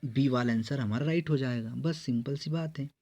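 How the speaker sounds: normal talking voice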